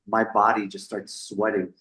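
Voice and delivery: ordinary talk, nothing marked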